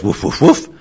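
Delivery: lightly imitated dog barks, rising; imitation woofs. Shown as a demonstration. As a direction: woof like a dog. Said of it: "the dog goes".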